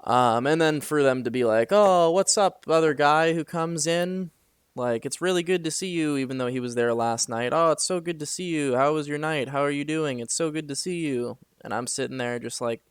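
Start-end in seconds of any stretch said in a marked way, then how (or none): 1.7-6.2 high, loud, fake-cheerful hello; sarcastic sing-song gushing; mock gushing greeting. Mocking coworkers greeting other guy. 7.5-11.4 sarcastic sing-song gushing; mock gushing greeting. Mocking coworkers greeting other guy.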